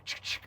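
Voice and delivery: soft mouth-made chick-chick of a rifle racking; imitated rifle loading click. A teen racks his rifle to scare two girls, soft clicking noise. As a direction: click it out like a gun being loaded. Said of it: "I go".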